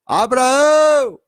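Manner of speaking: high, loud, long-drawn call; calling shout. Acted. Jesus calling Abraão in paradise, drawn-out shout. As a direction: call the name out across a great distance. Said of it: "Jesus tá gritando agora".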